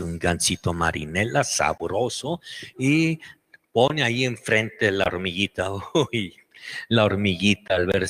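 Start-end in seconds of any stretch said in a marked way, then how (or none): none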